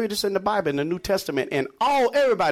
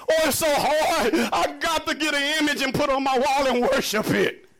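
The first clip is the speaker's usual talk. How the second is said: mock whining; 6 semitones higher than usual. Mocking complaints that the commandment is hard.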